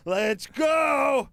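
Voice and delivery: high, loud barked command; drill sergeant shout. Retold or imitated. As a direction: yell it like a sergeant. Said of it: "like a drill sergeant".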